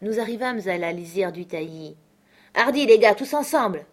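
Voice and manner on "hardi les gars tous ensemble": loud rallying cry, higher; shout. Acted. Pirate Mary shouting his men forward. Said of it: "cria Mary".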